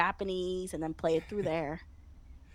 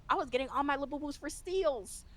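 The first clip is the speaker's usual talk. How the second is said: ordinary talk, nothing marked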